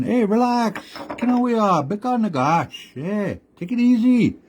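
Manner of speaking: calm the frogs while setting the trap; high, eager sly coyote pitch; smooth-talking Coyote. Acted. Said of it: "Coyote's there saying".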